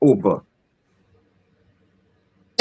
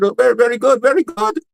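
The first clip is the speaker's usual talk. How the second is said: pitched-up gushing teacher praise; delighted teacher; 6 semitones higher, faster than usual. Retold or imitated. Teacher praising Chin's answer, pitched up and gushing. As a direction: gush over the pupil's answer, high and bright. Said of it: "the teacher said".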